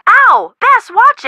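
high, loud yelp, then a sharp scolding; startled yelp. Acted. Paint gets flicked into her face, a loud startled yelp. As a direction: yelp and snap at the clumsy painter.

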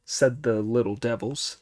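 plain reading voice

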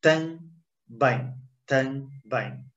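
TAM, pause, BÉM for "também"; syllable-by-syllable pronunciation. Shown as a demonstration. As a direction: say "também" slowly in two separate nasal syllables. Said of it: "we read it like".

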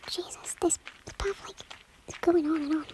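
hushed nervous whisper. Real, not acted. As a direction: whisper it as you climb. Whispering on steep path.

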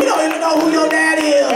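ordinary talk

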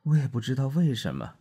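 groggy man apologizing, voice lifted; a lightly voiced drunk husband coming round; dazed husband. A narrator's reading. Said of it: "他说".

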